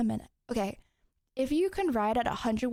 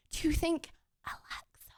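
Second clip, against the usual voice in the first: whispering; 4 semitones higher, 3 dB quieter than usual. Real, not acted. Hiding words from Alexa.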